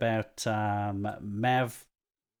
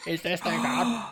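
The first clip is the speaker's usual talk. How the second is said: much higher, hammed-up puzzled old man; doddering old granddad; 8 semitones higher than usual. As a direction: play a granddad fumbling the webcam. Mocking old granddad on webcam.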